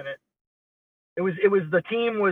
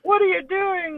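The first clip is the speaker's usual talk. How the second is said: mock shock; 13 semitones higher, slower than usual. Imitating shocked official.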